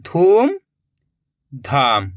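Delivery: धूम धाम split into two drawn-out halves with a long pause between; blend-and-read dictation of धूम ... धाम, slowly; word in two parts. Shown as a demonstration. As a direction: say धूम, hold off, then drop low on धाम. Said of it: "तो मिलके बन गया अपने".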